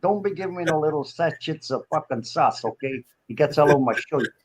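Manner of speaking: fussy New York mobster customer. Pretend. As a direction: grumble the order in a New York accent. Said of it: "New York voice and go and buy".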